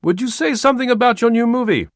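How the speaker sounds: high, loud reporter's voice; a new reporter calling a question; French reporter. Acted. Reporter questioning the star, high and loud. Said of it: "Now a different voice".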